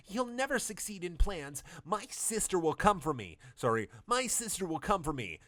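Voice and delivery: put-on captive girl's voice. Captive girl defying the villain, high defiant voice.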